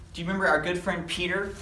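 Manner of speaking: plain reading voice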